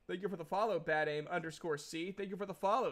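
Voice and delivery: normal talking voice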